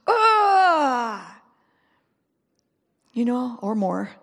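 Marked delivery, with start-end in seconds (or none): 0.1-1.4 long, loud groaning cry, high then falling; anguished groan. Shown as a demonstration. Preacher acting out prayer in the secret place, anguished groan. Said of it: "and just went".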